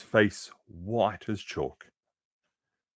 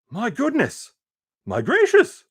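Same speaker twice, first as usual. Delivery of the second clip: very high, loud delighted cries; the crowd shouts in delight; shouted; 7 dB louder than usual. Acted. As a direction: gasp out in wonder. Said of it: "they shouted".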